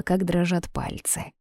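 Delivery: plain reading voice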